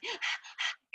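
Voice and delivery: sharp high gasp for air; mock breathless gasp. Instructor imitating a spinning rider, mock breathless gasp. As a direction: gasp like you're worn out mid-ride. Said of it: "You're like".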